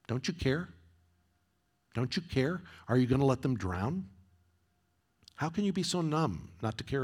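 pleading sermon read, pitch lifted; preaching lyrics. Acted. Preacher reads [name] lyrics to congregation, pleading. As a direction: press each question on the flock.

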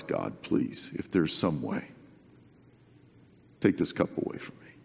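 whispered prayer. Acted. Jesus praying in Gethsemane.

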